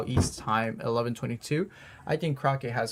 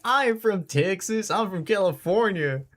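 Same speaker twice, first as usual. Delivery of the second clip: mock southern accent; 7 semitones higher, 3 dB louder than usual. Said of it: "Says it with the most southern accent".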